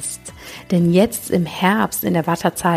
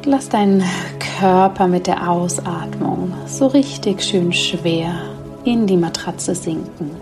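sleep meditation. Acted. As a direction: guide them gently into sleep.

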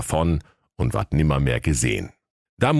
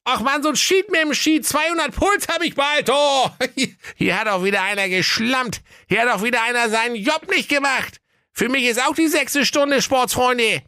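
put-on high, fed-up boy ranting; exasperated lad. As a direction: grumble like a worn-out kid. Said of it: "Da rief der Aladdin".

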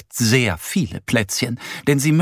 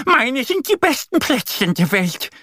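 duck explaining; 12 semitones higher than usual. Acted. Annie explaining the quarrel to Bärenmama, high duck voice. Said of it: "erklärt Annie".

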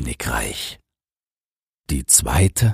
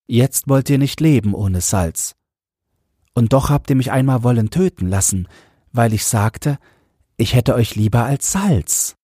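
higher, quick reproachful queen voice; 7 semitones higher than usual. A narrator's reading. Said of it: "sprach die Königin zu ihm".

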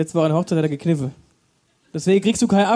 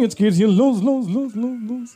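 bumper car announcer; 3 semitones higher, slower than usual. Retold or imitated. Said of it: "Wie so ein Autoscooter ansagt".

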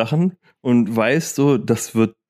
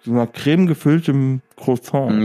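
chewing while talking. Real, not acted. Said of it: "Ich esse grad so ein".

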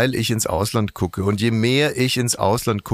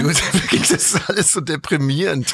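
laughing it off, pitched up; half-laughing mock despair; 6 semitones higher than usual. Real, not acted. Host laughs off the gloomy talk, laughing.

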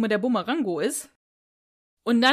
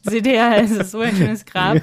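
laughing; 6 dB louder than usual. Real, not acted. Repeating the quote amused.

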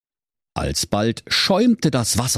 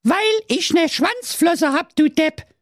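very high, saucy retort; cheeky mermaid. Acted. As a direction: toss back a cheeky insult. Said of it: "erwiderte die Meerjungfrau keck".

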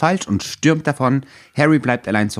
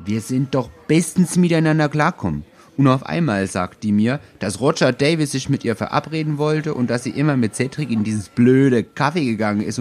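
indignant; 5 semitones higher than usual. Acted. Harry complains indignantly about Cho.

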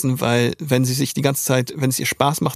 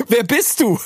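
Silvi's voice; 8 semitones higher than usual. Retold or imitated. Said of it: "Und dann sag ich".

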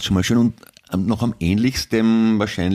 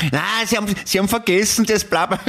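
much higher, chiding put-on voice; mock parliamentarian; 12 semitones higher, faster than usual. Playing a parliament packing game with his co-host, much higher mocking voice. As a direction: chide a colleague in a squeaky high voice.

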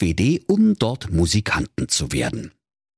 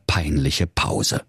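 ordinary delivery

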